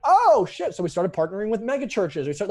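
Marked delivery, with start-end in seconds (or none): 0.1-0.7 sudden high, loud exclamation of realization; oh shit moment. Retold or imitated. Caught off guard, blurting out the realization. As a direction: blurt it out as it dawns on you.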